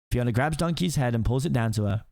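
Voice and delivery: ordinary delivery, nothing marked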